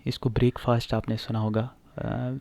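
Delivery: ordinary talk, nothing marked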